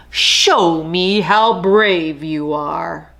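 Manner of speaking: slow, amused lion drawl; a lazy king unimpressed; lightly voiced lion; dry lion.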